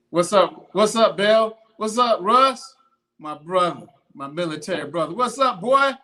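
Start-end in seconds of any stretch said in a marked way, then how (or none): 0.1-2.8 higher and louder hype greeting; loud shout-out. Real, not acted. Vlogger shouting greetings to his friends, loud. 5.2-5.9 higher and louder hype greeting; loud shout-out. Real, not acted. Vlogger shouting greetings to his friends, loud.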